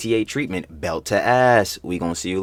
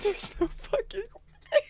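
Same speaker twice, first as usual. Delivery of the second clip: high, slow wailing disbelief; squealing disbelief; 8 dB quieter, slower than usual. Real, not acted. Reacting to the study, squealing in disbelief. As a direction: wail it like you can't believe it.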